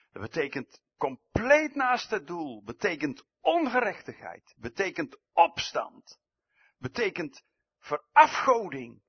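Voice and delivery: loud, insistent list; preacher listing what sin means, rising in force; forceful preaching. Acted.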